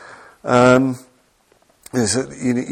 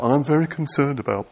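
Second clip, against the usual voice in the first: mock gossip voice; 5 semitones higher than usual. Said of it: "talking about".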